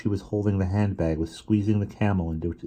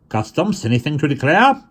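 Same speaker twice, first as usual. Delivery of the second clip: high, loud official call down the corridor; 9 semitones higher, 7 dB louder than usual. A narrator's reading. Customs officers calling along train.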